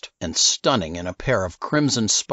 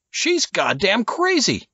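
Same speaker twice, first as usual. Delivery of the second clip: outraged outburst; 12 semitones higher than usual. Acted. Nichols shaken, outraged.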